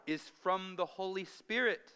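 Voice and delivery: "from the Holy Spirit" pitched high for emphasis; emphatic stress. Shown as a demonstration. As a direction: hit "from the Holy Spirit" firmly. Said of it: "See the emphasis?".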